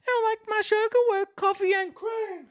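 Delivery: very high mock rap line; high squeaky rapper voice. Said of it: "you would have one person be like".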